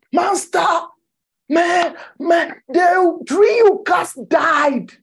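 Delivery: high, loud cry of amazement; astonished outcry. Acted. Disciple alerting his master to the dead tree, astonished. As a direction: shout it high, stretching out the words. Peter exclaims to Jesus in retold scene.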